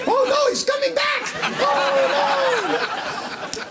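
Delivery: squealed high, loud fake terror; mock panic. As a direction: ham up the frightened subject. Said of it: "the look on your face is priceless".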